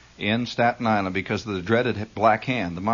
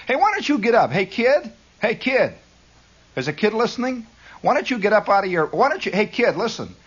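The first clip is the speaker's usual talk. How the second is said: put-on calling a kid; 8 semitones higher than usual.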